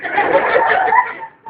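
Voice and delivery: risa aguda muy alta; ríete a gritos, agudísimo; carcajada gritada. Acted. (carcajada aguda a gritos)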